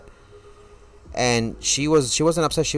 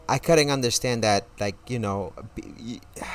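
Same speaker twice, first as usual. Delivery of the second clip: ordinary delivery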